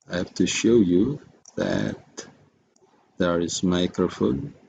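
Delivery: usual speaking voice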